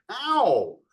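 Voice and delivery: high, loud, drawn-out mock "ow"; yelp of pain. Pinched partner reacting a second time, mock yelp. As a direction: cry out as if pinched hard.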